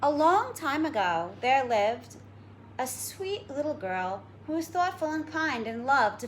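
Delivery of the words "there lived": ordinary delivery, nothing marked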